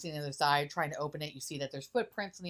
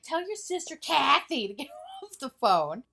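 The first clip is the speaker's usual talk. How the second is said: bratty demand; 8 semitones higher, 4 dB louder than usual. Retold or imitated. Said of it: "Josh is like".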